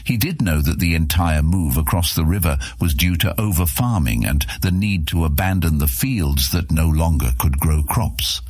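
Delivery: usual speaking voice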